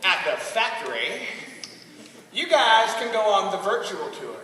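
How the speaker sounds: put-on ponderous old Southern jokester; Jim Spencer, joking. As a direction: set up a joke at a crawl. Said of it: "And so he said".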